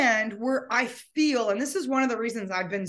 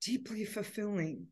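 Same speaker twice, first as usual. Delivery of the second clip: hushed, halting, choked-up voice; near tears; 5 dB quieter than usual. Real, not acted. Grieving owner remembering the trail with her dog, near tears. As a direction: let it drop almost to a whisper. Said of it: "as I start becoming a crying mess".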